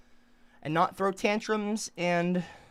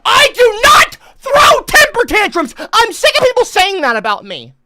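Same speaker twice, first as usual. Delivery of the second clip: crying shout; 14 semitones higher, 16 dB louder than usual. Real, not acted. Streamer sick of being called childish, crying shout. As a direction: wail the denial through tears.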